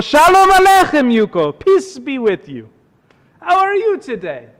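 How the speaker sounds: put-on high, loud accented rabbi; rabbi calling out. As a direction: hail him across the street in a high voice. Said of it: "the rabbi calls out to Yuko".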